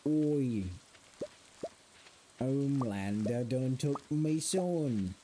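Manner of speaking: slow, drawn-out British accent attempt. Correcting the quote to son, attempted British accent. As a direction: stretch it out in a mock British accent. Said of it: "let me try to do that accent".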